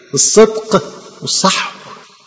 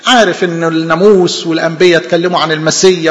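ordinary talk

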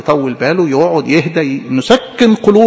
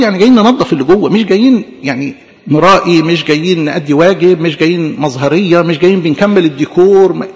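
ordinary talk, nothing marked

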